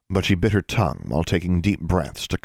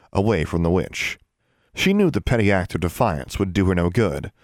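plain reading voice

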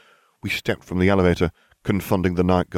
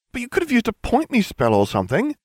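high girlish voice, lightly voiced; a young witch, curious; Hermione voice. A narrator's reading.

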